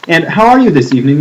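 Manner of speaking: normal talking voice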